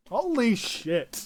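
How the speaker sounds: loud, slow stunned exclamation; excited exclamation. Real, not acted. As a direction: shout it out in disbelief. Big roll result reaction.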